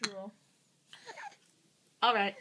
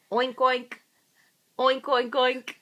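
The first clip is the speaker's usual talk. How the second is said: put-on low, louder oink oink for the baby; pig oinks; 4 semitones lower, 5 dB louder, slower than usual. Shown as a demonstration. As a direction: moderate oinking pig noise to a toddler. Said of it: "Does the pig say".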